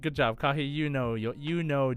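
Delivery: ordinary delivery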